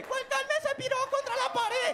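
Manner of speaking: high mock cop barking orders. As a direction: play a kid bossing like a street cop. Niño hace de policía. (niño imitando a un policía mandón)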